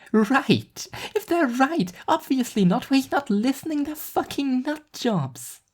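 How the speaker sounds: put-on a teenager sneering at the adults; scoffing high teen girl. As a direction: Nadine dismissing the cult with contempt.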